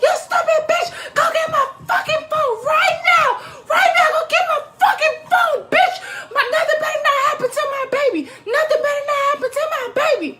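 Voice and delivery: high, loud screaming curses; screaming at friend while baby chokes; retold furious cussing. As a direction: cuss her out in full panic. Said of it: "cussing her out".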